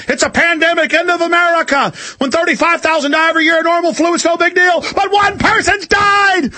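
high-pitched hammed-up panic, building to a shout; mock alarmist hysteria. Host mimicking TV alarmists demanding martial law, in mock hysteria.

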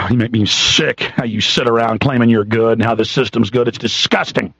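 low, sighing disgust. Real, not acted. Sickened host berating those who defend the system, disgusted. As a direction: let out a sigh, then drop low with contempt.

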